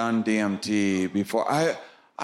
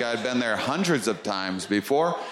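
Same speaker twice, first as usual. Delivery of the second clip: normal talking voice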